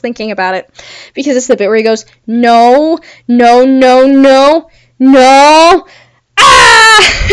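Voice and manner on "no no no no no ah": hammed-up "no"s building into a full scream; Riddler's screaming meltdown. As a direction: imitate a movie villain's rising, loud wail. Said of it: "and then just screams".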